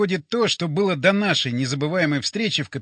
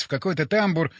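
plain reading voice

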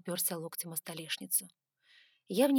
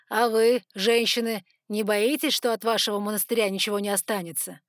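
louder, with a touch of mockery; mocking smirk; 2 semitones higher, 8 dB louder than usual. A narrator's reading. Sargon taunting the nuns, mocking smirk. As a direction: put the question with a smirk. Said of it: "с усмешкой спросил Саргон".

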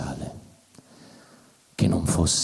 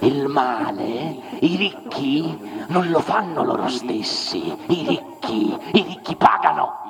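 building outcry; 11 semitones higher, 4 dB louder than usual. Acted. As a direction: climb higher and louder until you shout pagano. Rising tirade against the rich. (crescendo fino a gridare pagano)

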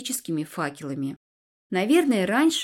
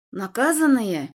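slow, louder hoarse ghost croak; rasping ghost; 5 dB louder, slower than usual. Acted. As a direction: rasp it like a spectre. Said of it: "прохрипела полупрозрачная тень".

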